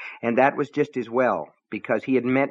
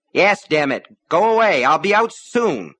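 loud, high, agitated yelling; angry shout; 7 semitones higher, 5 dB louder than usual. A narrator's reading. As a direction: yell to be left alone. Husband yells through locked door.

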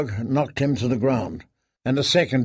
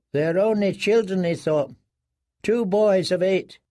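higher, musing inner voice; prisoner's thought; 6 semitones higher than usual. A narrator's reading. Prisoner sizing up the boys, higher inner voice. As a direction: think it, half to yourself.

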